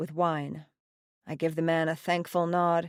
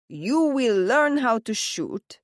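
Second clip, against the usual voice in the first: slow, higher, louder stern father order; commanding father; 6 semitones higher, 4 dB louder, slower than usual. A narrator's reading. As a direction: lay down the law like a mob boss.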